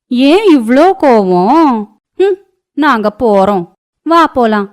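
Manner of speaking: high, loud, teasing neighbour woman voice; a gossiping neighbour poking fun, pitched high; nosy neighbour. Acted. Neighbours mocking Anju. (பக்கத்து வீட்டுப் பெண் கிண்டலாக, உயர்ந்த குரலில்)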